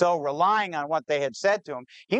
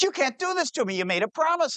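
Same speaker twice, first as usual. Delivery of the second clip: pitched way up, pleading in disbelief; desperate plea; 10 semitones higher, faster than usual. Acted. Cheated builder begging the supplier on the phone, high desperate plea. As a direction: beg him to keep his word.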